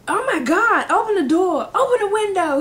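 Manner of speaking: high, loud urgent orders; mock frantic shouting. Imitating coach ordering class to air room, frantic shout. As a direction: holler commands in comic alarm.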